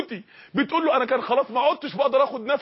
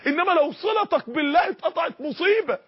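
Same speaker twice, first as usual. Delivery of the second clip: preaching shout; 5 semitones higher than usual. Real, not acted. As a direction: cry out the warning to the crowd. (صيحة واعظ على مصيبه)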